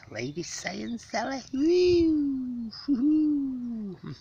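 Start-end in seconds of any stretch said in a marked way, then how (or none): 1.5-4.1 high whooping hoots; gleeful high hoots; excited whoop. Real, not acted. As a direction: let out a delighted woo.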